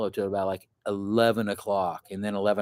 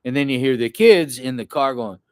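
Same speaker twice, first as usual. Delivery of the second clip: usual speaking voice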